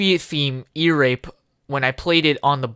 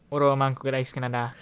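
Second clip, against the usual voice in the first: very fast, lower, softer; mock Japanese; 5 semitones lower, 8 dB quieter, much faster than usual. Goofing in Japanese, fast mock babble. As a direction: rattle it off fast.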